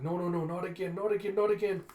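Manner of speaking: rising alarm building to a loud, high shout; acted panic. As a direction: frantically warn everyone to flee. Acted panic and warning to flee.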